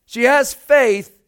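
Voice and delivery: loud, high, rising preacher voice; full-force preaching. Acted. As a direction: preach it out to the congregation.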